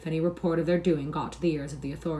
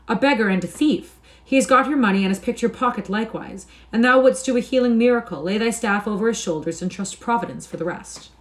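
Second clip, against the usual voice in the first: accusing; 5 semitones higher, 5 dB louder than usual. A narrator's reading. King denounces Hugo.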